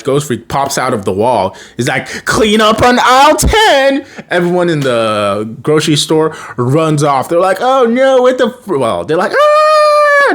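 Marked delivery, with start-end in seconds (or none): 2.2-4.1 slow, much higher and louder ghostly taunt; hammed-up monster menace; spooky ghost voice. Ghost Freak pops out of wall. 7.6-8.8 much higher, louder mock panic; hammed-up fright; panicked shoppers. Shoppers fleeing. 9.3-10.4 very high, loud mock scream; hammed-up crowd scream; normal people screaming. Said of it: "Normal screaming people voice".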